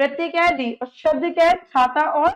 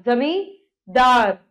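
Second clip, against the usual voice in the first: drawn out slowly; 3 dB louder, much slower than usual. Shown as a demonstration. Teacher dictating words to class.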